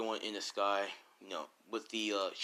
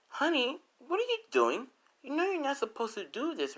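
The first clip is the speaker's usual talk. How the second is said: mother's scolding voice. Acted. Mother catching her daughter.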